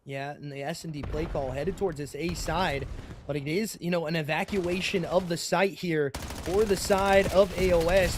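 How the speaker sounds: usual speaking voice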